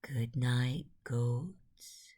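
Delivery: ordinary delivery, nothing marked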